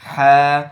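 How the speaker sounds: হা breathed loud from mid-throat; breathy Arabic ha. Shown as a demonstration. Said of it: "মধ্যখান থেকে".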